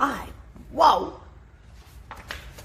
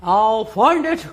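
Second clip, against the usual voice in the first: louder, slow, resolute; elephant's slow firm cry; determined cry; 6 dB louder, slower than usual. A narrator's reading. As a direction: vow it out loud. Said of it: "cried Horton".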